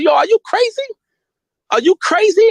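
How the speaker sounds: high, exasperated "are you crazy"; high-pitched retold disbelief; incredulous scolding. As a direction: scold a reckless friend in disbelief. Retelling scolding Poe.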